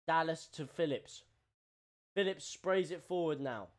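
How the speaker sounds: brisk running football commentary; live play-by-play. Acted. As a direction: call the match as it unfolds. Commente le match en direct.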